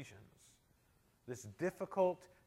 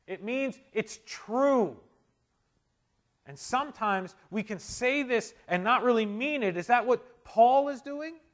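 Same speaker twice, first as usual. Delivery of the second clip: high, loud, ringing exhortation; full-force preaching; 9 semitones higher, 12 dB louder, slower than usual. Real, not acted. Preacher urging the church to ask God now, loud and high. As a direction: drive it home from the pulpit.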